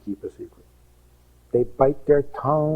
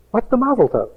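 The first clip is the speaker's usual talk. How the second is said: high, mock-innocent question; feigned puzzlement; 12 semitones higher, 4 dB louder than usual. Secretary to groom's friends, high feigned puzzlement. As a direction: play dumb as if you know nothing. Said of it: "turns to me and says".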